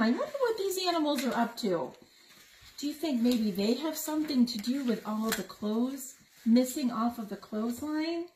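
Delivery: ordinary delivery, nothing marked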